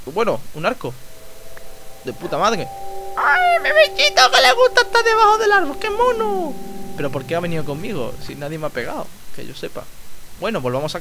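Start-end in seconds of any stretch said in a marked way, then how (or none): 3.2-6.5 squeaky cutesy voice, very high; falsetto gushing. Acted. Player gushing over the little creature under the tree, falsetto gushing. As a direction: coo loudly over a pet in falsetto.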